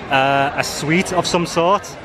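usual speaking voice

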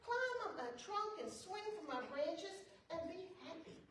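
put-on a tree overjoyed to see the boy back; joyful tree welcoming the boy home. A narrator's reading. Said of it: "the tree shook with joy and said".

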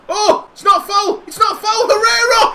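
high, loud, rising protest; building shout. Real, not acted. Gamer protesting the ref, building shout. Protesting the call, then goal.

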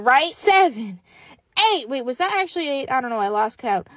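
ordinary delivery

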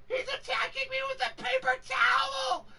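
very high, squealed cry for help; mock screaming panic. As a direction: squeal in hammed-up terror. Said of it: "I screamed like a B I T C H".

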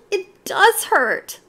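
put-on high, slow, sorry-for-herself child; hurt little child. A narrator's reading. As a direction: drag it out, small and pitiful. Child in pain after bump.

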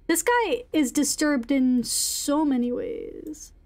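normal talking voice

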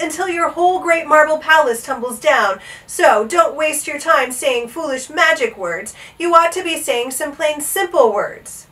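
high, loud scolding; shouting. A narrator's reading. Bartholomew scolds the king over magic words, shouting. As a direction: shout it at a ruler who needs telling. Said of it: "he shouted".

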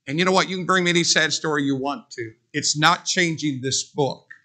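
normal talking voice

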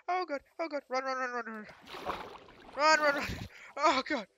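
high, panicked running cries. Real, not acted. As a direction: cry out while fleeing in panic. Fleeing again in panic.

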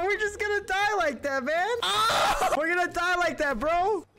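high-pitched frantic yelling; panicked protest. Real, not acted. Player about to die like that, frantic high protest. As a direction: wail in disbelief, pitch way up.

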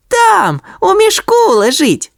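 very high shout; calling out. A narrator's reading. Sonya shouts to the worker below, high. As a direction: shout it down into the hatch. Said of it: "крикнула Соня".

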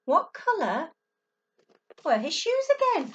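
ordinary delivery

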